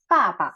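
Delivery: Papa with a loud falling first syllable, toneless second; falling tone, light ending. Shown as a demonstration. Teacher showing the toneless last syllable, falling Papa. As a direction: punch the first Pa, toss off the last. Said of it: "Jadi yang pa terakhir ini dibaca".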